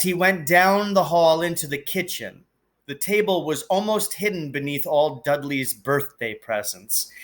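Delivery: ordinary delivery, nothing marked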